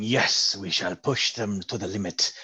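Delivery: slow, low scheming villain; evil villain voice. Pretend. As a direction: gloat like a cartoon supervillain. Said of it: "excellent evil villain voice".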